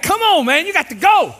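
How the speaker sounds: loud, high urging at the car ahead; impatient call. Shown as a demonstration. Pastor voicing an impatient driver, loudly urging. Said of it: "We got".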